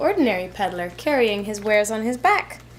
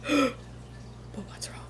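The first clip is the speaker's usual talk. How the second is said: hushed worried whisper; 12 dB quieter, much slower than usual. A narrator's reading. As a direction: gasp then a slow whisper. Waking to missing caps.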